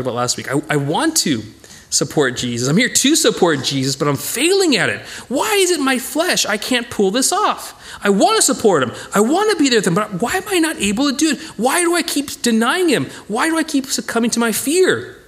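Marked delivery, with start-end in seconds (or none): 5.3-15.2 high, pleading cry of frustration; anguished self-questioning. Acted. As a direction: beg to know why you keep failing. Peter's anguished inner cry.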